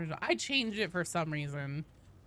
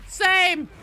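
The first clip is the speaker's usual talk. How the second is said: loud, high burst of agreement; excited shout; 11 semitones higher, 13 dB louder than usual. Real, not acted.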